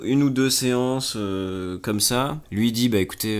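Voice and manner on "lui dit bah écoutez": usual speaking voice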